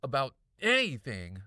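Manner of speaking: loud scream, building to the end; angry kid screaming back at her brother. A narrator's reading. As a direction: scream it back over your shoulder. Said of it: "I screamed".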